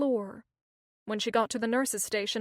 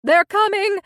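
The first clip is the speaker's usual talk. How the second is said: loud, high shout after running; breathless shout; 9 semitones higher, 12 dB louder than usual. A narrator's reading. Lourdes back from the hall, shouting out of breath. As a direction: call it out winded from the run. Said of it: "she shouted, out of breath".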